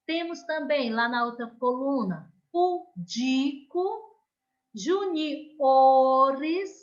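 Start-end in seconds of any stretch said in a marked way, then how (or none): 2.5-6.7 "pudico" slow with a rise and the "di" raised; teacher modelling stress for kids; stressed syllable demo. Shown as a demonstration. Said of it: "Olha o som da penúltima mais forte".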